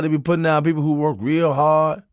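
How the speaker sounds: ordinary delivery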